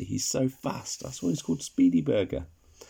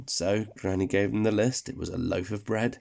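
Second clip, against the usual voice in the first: ordinary delivery, nothing marked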